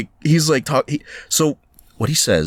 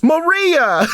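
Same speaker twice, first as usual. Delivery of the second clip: high, theatrical call; mock catchphrase cry; 3 dB louder than usual. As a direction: cry out the name high and hammed-up. Quoting a stereotypical catchphrase.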